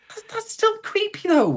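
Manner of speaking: very high, insistent objection; appalled protest. Real, not acted. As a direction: push back in high-pitched disbelief. Protests over the other speaker.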